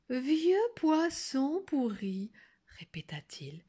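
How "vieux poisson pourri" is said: sweetest voice. A narrator's reading. Wolf repeats the girl's insult, in his sweetest voice. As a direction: draw the words out ever so gently. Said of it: "prit sa voix la plus douce".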